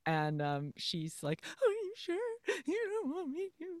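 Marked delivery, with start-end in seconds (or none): 1.4-3.7 very high, whimpering heroine imitation; mock tearful pleading. Heroine asks the man sending her away, mock tearful. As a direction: plead in a squeaky pretend sob.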